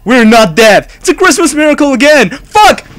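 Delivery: high, loud disbelieving cheer; frantic relief. Real, not acted. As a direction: shout it, stunned to be alive. Survives again in horror game.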